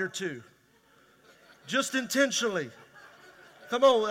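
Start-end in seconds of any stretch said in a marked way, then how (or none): none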